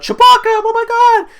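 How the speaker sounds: very high, loud cry of horror; mock anguished yell. Rey crying out for Chewbacca after the blast, mock yell. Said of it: "She yells out".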